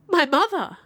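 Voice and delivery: high, loud exclamation; acted outburst, pitch leaping up then falling; startled cry. A narrator's reading. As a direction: cry out in sudden astonishment. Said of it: "cried I".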